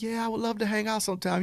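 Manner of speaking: high-pitched imitation of a woman agreeing; woman accepting. Woman answering a suitor's invitation, high-pitched mock female reply. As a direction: play the interested woman, pitched well up. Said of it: "And she's like".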